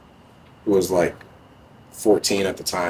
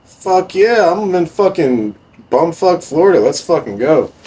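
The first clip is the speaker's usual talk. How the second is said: high, loud hyped "fuck yeah"; thrilled yell accepting the Germany trip; 10 semitones higher, 4 dB louder than usual. Retold or imitated. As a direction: shout it like you're finally escaping. Own thrilled reply, retold.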